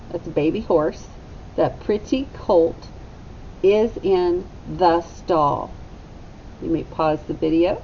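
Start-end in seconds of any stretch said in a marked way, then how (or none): 1.5-5.7 slow, clear model sentence for the class. Shown as a demonstration. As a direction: say it slowly for children to repeat. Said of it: "Echo me".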